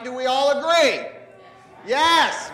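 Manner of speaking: rallying call. Acted. Rallying the children to answer.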